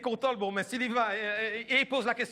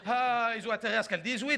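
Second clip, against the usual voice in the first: ordinary talk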